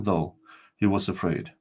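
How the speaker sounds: usual speaking voice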